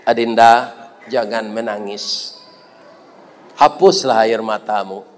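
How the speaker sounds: low, tender reassurance; acted gentle consoling. Doctor comforts the weeping patient from behind, gently.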